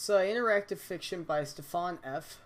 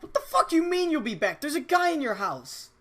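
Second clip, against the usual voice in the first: high, loud shocked yell; incredulous shout; 10 semitones higher, 8 dB louder than usual. Acted. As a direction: shriek in disbelief. Disbelief at friend leaving.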